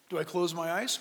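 low, soft mock question; doubtful questioner. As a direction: drop down low and quiet as a dim questioner.

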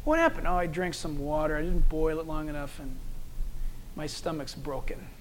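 a sick friend barely getting the words out; weak sick murmur. Retold or imitated. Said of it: "he's like, bent over".

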